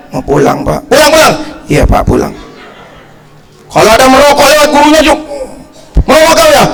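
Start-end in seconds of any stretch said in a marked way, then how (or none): 0.1-0.9 low, soft reply to teacher; imitating a timid schoolchild; meek pupil. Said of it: "Kalau dulu coba gurunya lewat". 0.9-1.3 higher, curt mock order; imitating a teacher's command; stern teacher. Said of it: "gurunya lewat". 1.7-2.3 hushed, voiceless compliance; obey the teacher timidly; imitating a cowed schoolchild; meek pupil. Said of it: "gurunya lewat". 6.1-6.7 high, sharp questioning; imitating a teacher's accusation; accusing teacher. Teacher catches pupil smoking.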